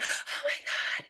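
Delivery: soft, breathless whispered panic; student freezing up in the exam room, whispered panic; panicked whisper. Retold or imitated. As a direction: whisper it like exam nerves hit. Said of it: "when you get in there and you're like".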